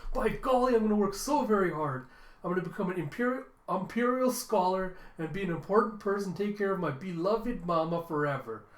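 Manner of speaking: high, hammed-up earnest child; grand, gushing mock vow; way exaggerated. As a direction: declare it with overblown zeal. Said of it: "Way exaggerated".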